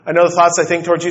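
mock child reciting at breakneck speed; rattled off fast. Father imitating his son Isaac rattling off a memory verse, fast rushed recital. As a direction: blurt the memorized verse in one rushed breath. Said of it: "he'll say it like that".

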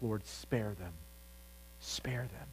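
soft, sinking prayer for mercy; hushed plea. Retold or imitated. Moses begging God to spare the people, softly. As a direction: plead quietly for the people.

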